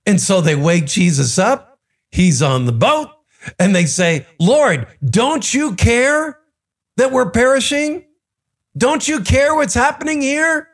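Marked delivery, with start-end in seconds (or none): 4.4-10.7 high-pitched, pleading mock distress; freaking out. Disciples waking Jesus in the storm, freaking out. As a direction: plead it high, acting out desperation. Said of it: "They are freaking out".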